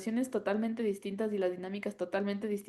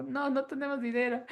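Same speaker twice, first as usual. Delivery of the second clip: put-on sheepish children; 4 semitones higher than usual. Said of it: "los niños así como".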